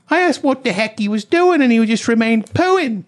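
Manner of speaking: high-pitched hammed-up British woman; mock British. Garden owner recounting the squatter, hammed-up British. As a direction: put on an indignant British voice, up high. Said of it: "She said, this is real".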